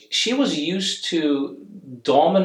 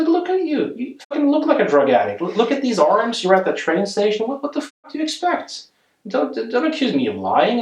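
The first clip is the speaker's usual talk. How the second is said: high indignant scolding, faked outrage; mock angry outburst; 9 semitones higher than usual. Therapist fakes fury at his patient, high indignant scolding. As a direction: feign fury to shut her accusation down. Retelling yelling at her, his own voice.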